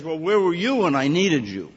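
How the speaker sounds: mock-indignant rebuke; accusing retort. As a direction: throw the blame back at them. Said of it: "He says".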